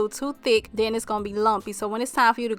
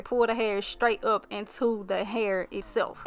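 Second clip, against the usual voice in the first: ordinary talk, nothing marked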